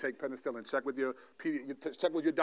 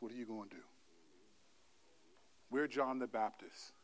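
much softer, intimate sermon voice; gentle soft preaching; 3 semitones lower, 8 dB quieter than usual. Real, not acted. Pastor gently addressing the congregation, soft and intimate. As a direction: speak it as a tender, quiet adjustment.

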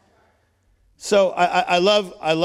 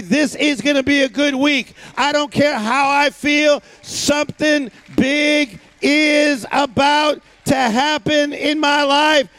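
bold declaration; 3 semitones higher than usual. Pretend. Preacher leading the church to declare faith boldly. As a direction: proclaim it like you believe it.

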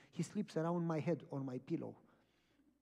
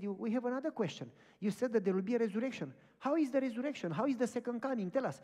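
eager questions; 6 semitones higher, 5 dB louder than usual. Retold or imitated. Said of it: "they said".

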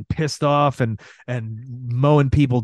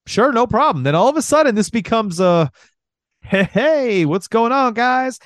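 cheery gas attendant; 10 semitones higher than usual. Retold or imitated. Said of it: "And they're just like".

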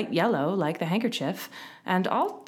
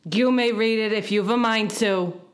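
raised, louder sulky voice; mildly huffy character voice; 5 semitones higher, 5 dB louder than usual. A narrator's reading. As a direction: give grudging permission. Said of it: "said rather sulkily".

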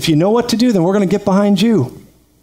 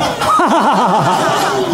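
demonstration laugh. Shown as a demonstration. Pastor leading congregation in a deliberate laugh, hearty. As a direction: lead the room in a big laugh. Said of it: "let's just laugh".

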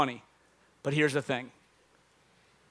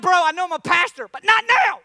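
high, loud comic pleading; exasperated shout; 6 semitones higher, 5 dB louder than usual. Retold or imitated. Pastor begging treadmill neighbor to stop talking, exasperated shout. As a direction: shout him off, climbing in pitch. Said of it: "I'm like".

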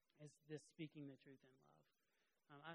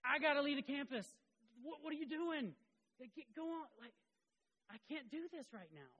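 high, loud mock-frantic protest; hammed-up exasperation, pitched way up; acting out own panic; 10 semitones higher, 10 dB louder than usual. Husband to crying wife at the conference, mock-frantic outburst. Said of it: "and I was like".

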